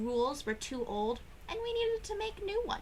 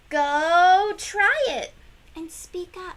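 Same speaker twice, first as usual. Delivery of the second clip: slow, much louder urging call; loud encouragement; 5 semitones higher, 13 dB louder, slower than usual. Acted. Teacher loudly urging the kids to try. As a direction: stretch it out loud to cheer them on.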